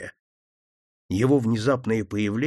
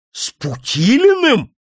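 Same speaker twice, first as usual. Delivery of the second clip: startled, high and louder, slow; frightened cry; 11 semitones higher, 5 dB louder, slower than usual. Acted. Startled couples hearing the detective's name, frightened. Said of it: "испуганные возгласы".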